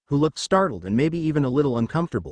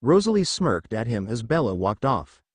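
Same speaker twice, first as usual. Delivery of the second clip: plain reading voice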